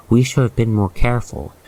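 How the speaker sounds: usual speaking voice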